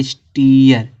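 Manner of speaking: स्टीयर said as "is-TEE-yar", with a long ee; teacher modelling स्टीयर with a long ee gliding into "yar"; blended pronunciation. Shown as a demonstration. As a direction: sound out स्टीयर as one joined word, ee stretched. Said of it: "सब मिला देंगे तो क्या होगा".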